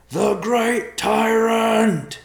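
loud drawn-out warning call; shouted warning. A narrator's reading. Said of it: "called out in warning".

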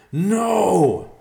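loud roaring no. A narrator's reading. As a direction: roar in grief and rage. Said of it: "Riverpaw roared".

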